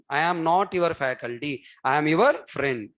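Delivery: normal talking voice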